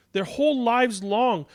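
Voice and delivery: ordinary delivery, nothing marked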